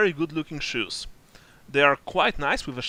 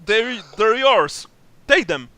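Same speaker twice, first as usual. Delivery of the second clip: terrified; 7 semitones higher, 7 dB louder, slower than usual. Acted. NPC surrendering shoes in fear.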